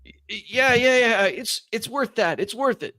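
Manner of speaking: high, stammering agreement; flustered old man. Acted. Old man conceding package's worth, flustered stammer. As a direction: stammer eagerly as you give in.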